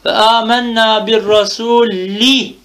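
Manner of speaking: slow recitation with final "i" of "rasuli" stressed; drawn-out kesra ending. Shown as a demonstration. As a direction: stretch "rasuli" to show its "i" ending. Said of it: "mecrurun bil kesrati zahira".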